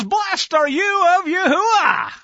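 high, loud, drawn-out proclamation; hearty mock greeting. Saul grandly greets the prophet, hammed-up hail. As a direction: sing out the blessing, hammed up.

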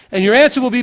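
normal talking voice

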